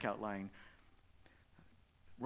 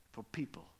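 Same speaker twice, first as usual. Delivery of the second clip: usual speaking voice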